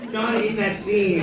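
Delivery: incredulous, laugh in the voice; amused disbelief. Retold or imitated. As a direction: point it out in mock bafflement. Incredulous objection to Tommy, retold.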